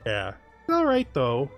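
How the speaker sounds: ordinary talk, nothing marked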